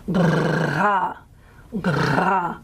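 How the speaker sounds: uvular trill on bra, back of throat; throaty r. Shown as a demonstration. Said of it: "I'm having trouble maintaining the voicing".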